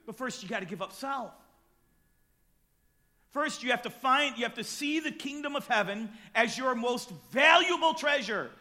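impassioned sermon, building to shouts; preaching. Acted. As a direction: preach it with fire.

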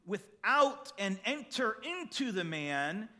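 solemn scripture reading. Acted. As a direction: read the verses with weight, letting the list sink in. Reads Mark chapter seven aloud.

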